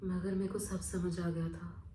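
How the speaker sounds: low, soft, grave telling; hushed realisation. Acted. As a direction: drop the voice right down. Grim realisation before the escape. (धीमी, दबी आवाज़ में)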